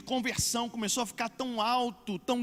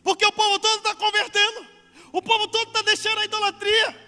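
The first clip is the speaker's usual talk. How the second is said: high, loud mock alarm; worried craftsmen; 11 semitones higher, 7 dB louder than usual. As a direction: imitate tradesmen fretting in a raised, high-pitched voice. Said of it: "Começaram a ficar preocupados". (imitando artesãos preocupados, voz alta e aguda)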